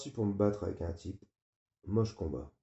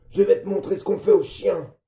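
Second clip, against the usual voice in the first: furious high bellow of a father; father yelling; 10 semitones higher, 13 dB louder than usual. Acted. Father yelling at his drunk son at the door.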